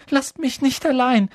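high, loud panicked call; pleading cry. A narrator's reading. Neville calls after his departing friends, pleading. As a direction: cry out not to be left. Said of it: "rief Neville".

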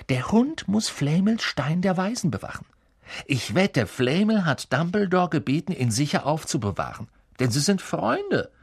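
Hermine, eager. A narrator's reading. Hermine explaining the secret to her friends, excited.

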